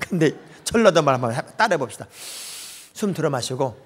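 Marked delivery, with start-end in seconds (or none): none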